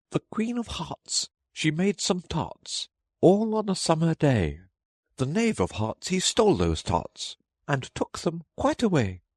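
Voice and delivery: high, sing-song herald recital; herald reading. A narrator's reading. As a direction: proclaim the rhyme to the court. Rabbit reads accusation.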